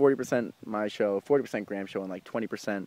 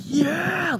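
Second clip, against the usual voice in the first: excited yell. Retold or imitated. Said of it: "I was like".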